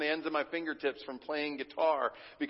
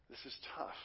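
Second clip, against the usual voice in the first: whispered; 2 semitones lower, 16 dB quieter, faster than usual. Real, not acted. Repeated line, hushed repeat.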